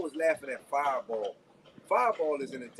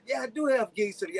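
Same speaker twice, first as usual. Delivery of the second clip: lightly imitated radio rival, higher; Fireball; 7 semitones higher than usual. Friend retelling a radio rival's comeback, lightly imitated. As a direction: retort in a rival's voice, pitched up. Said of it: "he said".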